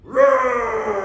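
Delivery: loud playful mock roar; hammed-up dragon roar. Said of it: "give us your best roar".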